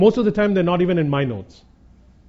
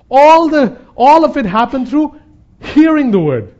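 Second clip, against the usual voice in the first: raised, forceful preacher's voice; louder and higher, driving the point home; emphatic preaching; 6 semitones higher, 6 dB louder than usual. Real, not acted. Preacher stressing to the congregation how it all happened, emphatic.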